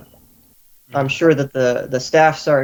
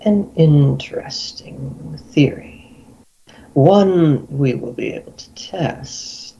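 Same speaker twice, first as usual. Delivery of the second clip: very slow, stretched words, some near whisper; deliberate Guildmaster granting a reprieve; 2 semitones higher, much slower than usual. Acted. Guildmaster grants captive a few more hours, slow and deliberate. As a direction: draw out each phrase with menace.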